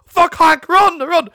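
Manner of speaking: very high, loud comic panic; panicked yell. Acted. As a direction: yell to run for your life. Acted panic as camp hears them.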